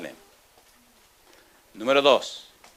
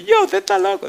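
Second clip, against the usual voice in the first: much higher, amused student voice; laughing disbelief; 6 dB louder than usual. Retold or imitated. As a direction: scoff through a laugh. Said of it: "Y empezó a reírse".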